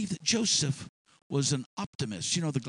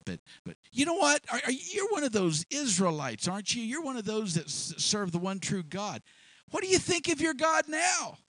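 higher, jeering challenge; sneering higher prisoner voice; scoffing prisoner; 6 semitones higher than usual. Acted. Prisoner mocking Joseph's faith.

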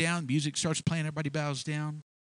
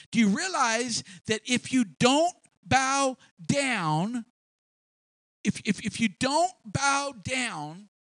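higher, emphatic scolding, drawn out on key words; exasperated warning; 8 semitones higher, slower than usual. Acted. Official confronting captives who will not bow, exasperated and insistent. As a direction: press each word on a stubborn listener. Acted rebuke of the refusers.

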